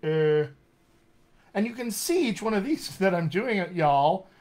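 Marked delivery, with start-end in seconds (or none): 0.1-0.5 held French thinking sound; French hesitation. Shown as a demonstration. Coach shows another accent's sound, French hesitation. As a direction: hesitate as a French speaker would. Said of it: "if there's a French accent".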